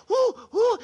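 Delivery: high hooting owl who. Acted. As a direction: hoot it like a wise old owl. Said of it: "the wise old owl".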